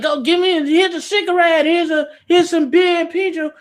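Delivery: high, pushy voice offering drinks around; mock pushy drinker. Man pushing drinks on the girls at the bar, in a mocking high voice. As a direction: ham up a guy pushing beer and cigarettes.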